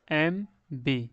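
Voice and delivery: ordinary delivery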